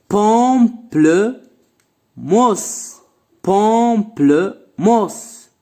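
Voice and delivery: very slow, each syllable drawn out. Shown as a demonstration. Teacher to pupils, counting syllables, very slow and drawn out. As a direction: stretch Pamplemousse so pupils hear every syllable. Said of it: "teacher slows word into syllables".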